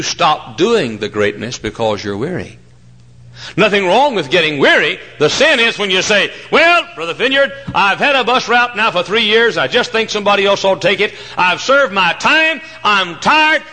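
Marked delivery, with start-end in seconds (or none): 3.5-13.6 high, loud sermon delivery with a mock complaint; preaching at full force. Preacher mimicking a tired bus worker to his pastor, preaching at full force. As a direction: preach it big while voicing the quitter.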